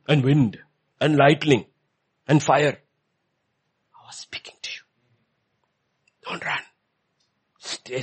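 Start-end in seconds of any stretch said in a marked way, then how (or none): 3.9-4.8 unvoiced whisper. God whispering to Elijah, unvoiced. 6.3-8.0 soft whisper of God. Acted. Preacher voicing God to Elijah, whispered.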